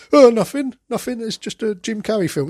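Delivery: high, rushed flustered denial; flustered cover-up. Retold or imitated. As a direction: caught out, brushing it off. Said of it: "It's like".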